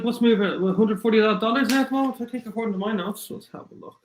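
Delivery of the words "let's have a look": muttered aside. Real, not acted. Muttering to himself, checking notes.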